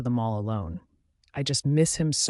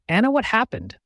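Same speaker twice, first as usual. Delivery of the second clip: higher, louder yell; 8 semitones higher, 4 dB louder than usual. A narrator's reading. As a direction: yell it in frustration. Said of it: "He yells at me again".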